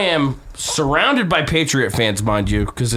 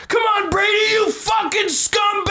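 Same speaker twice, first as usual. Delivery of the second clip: very high, drawn-out fan scream; screaming heckle; slower than usual. Retold or imitated. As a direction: scream abuse at the quarterback from the stands. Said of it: "like, screaming".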